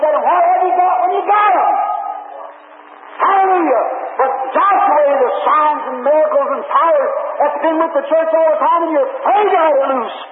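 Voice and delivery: high, driving revival sermon voice; full-force preaching. Real, not acted.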